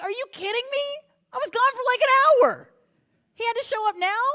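hammed-up disbelief at missing out; exasperated outburst. Thomas protests to friends, mock indignant yell. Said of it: "And Thomas is like".

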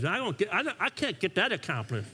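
normal talking voice

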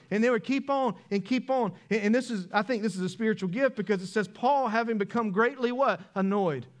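loud, high, driving sermon voice; full-force preaching. Acted. Pastor preaching to the congregation, loud and driving.

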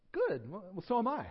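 mildly eager "me as well" tone; pleased agreement. Acted. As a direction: agree happily, voice jumping up. Preacher delighted to find common ground.

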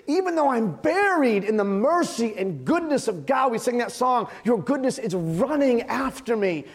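high-pitched, emphatic sermon build; impassioned preaching. Acted. Pastor confessing to his church amid God's blessings, impassioned preaching. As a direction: preach it with drawn-out key words.

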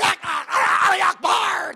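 imitating a screaming crowd; mock scream. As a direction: belt it out like an angry mob. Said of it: "So they're screaming".

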